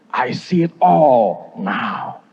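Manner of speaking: drawn-out, wondering realization; slow awed English line; slow revelation. Acted. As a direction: let each word land slowly, in awe. Preacher voicing a believer's revelation.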